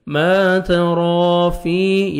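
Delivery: ما تَرَى فِي slow with the alif held; slow tajweed drill; stretched ما. Shown as a demonstration. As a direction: hold the alif of ما. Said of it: "ما الف مدہ one second میم کو کھینچنا ہے".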